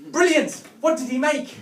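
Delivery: put-on high, loud, delighted kid voice; excited child. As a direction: squeal it like a thrilled young boy.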